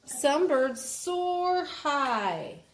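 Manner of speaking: very slow, word by word; slow, careful reading aloud. Shown as a demonstration. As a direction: read it slowly for a child. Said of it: "reading the book line slowly".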